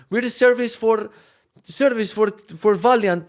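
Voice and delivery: thick mock Polish accent, high and loud; heavier Polish accent. Service people introducing themselves, thick mock Polish accent. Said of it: "with way heavier Polish accent".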